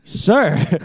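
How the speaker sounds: high, loud, mock-patient "sir"; exasperated server. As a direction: retell a server losing patience. Retold exasperated reply to stubborn customer.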